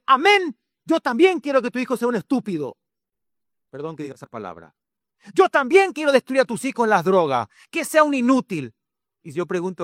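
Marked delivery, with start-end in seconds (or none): 0.1-2.8 high-pitched, fast devil impersonation; devil agreeing eagerly. Acted. Devil seconding a father's curse, high eager declaration. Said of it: "el diablo dice". 5.3-8.7 high, forceful devil impersonation; devil cursing. Acted. Devil vowing to ruin the children, high forceful declaration. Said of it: "el diablo dice".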